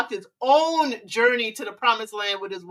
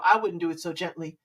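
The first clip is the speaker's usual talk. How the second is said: usual speaking voice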